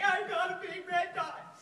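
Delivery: loud, slow, high dorky announcement; dorky diva. Retold or imitated. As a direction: blurt it out like a goofy diva. Her dorky diva self.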